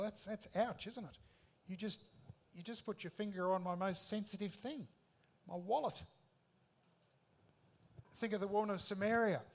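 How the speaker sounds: ordinary delivery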